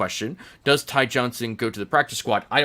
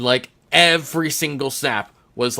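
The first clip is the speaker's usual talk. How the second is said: usual speaking voice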